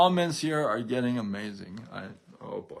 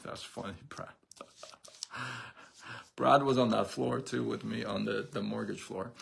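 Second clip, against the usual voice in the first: ordinary talk, nothing marked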